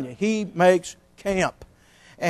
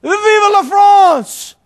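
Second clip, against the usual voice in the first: high, slow, shouted French cry; hammed-up French patriot shout; Frenchman's cry; 13 semitones higher, 8 dB louder, much slower than usual. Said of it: "The Frenchman steps up to the door".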